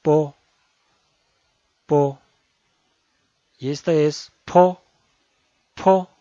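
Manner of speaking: po with a strong breath of air; aspirated p. Shown as a demonstration. Teacher shows aspirated po, paper would move. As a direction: push air out on po. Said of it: "Tiene una aspiración".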